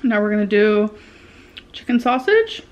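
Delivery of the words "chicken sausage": ordinary talk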